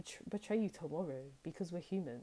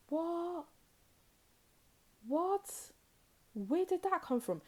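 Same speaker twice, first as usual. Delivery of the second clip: much higher, shocked repeated what; mock stunned; 13 semitones higher, slower than usual. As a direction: react as if blindsided. Partner suddenly turns aggressive.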